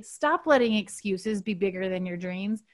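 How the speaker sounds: ordinary delivery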